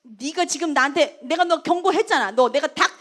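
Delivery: a pretend Jesus grilling Peter, loud and mocking; mock furious interrogation. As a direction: corner him and demand an answer. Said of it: "예수님이 따져도 백 번을 따질 수 있었어요".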